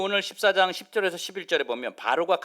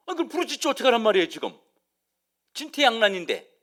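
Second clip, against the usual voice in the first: exasperated protest; 3 semitones higher, 4 dB louder, faster than usual. Acted. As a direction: talk back in disbelief, fast and raised. Acted protest at God's answer. (어이없다는 듯 높고 빠르게 항변)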